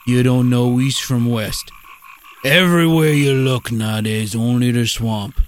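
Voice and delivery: put-on Cajun accent. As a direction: spin a swamp tale in a Cajun accent. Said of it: "Cajun fellas".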